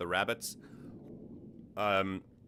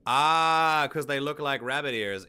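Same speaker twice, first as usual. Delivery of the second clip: surprised; 6 semitones higher, 7 dB louder, slower than usual. Real, not acted. Sudden realization about rabbit ears.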